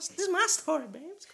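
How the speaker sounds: high, squeaky sassy put-on voice; high camp voice calling her babes; mock sassy voice. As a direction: play it up like a diva telling her story.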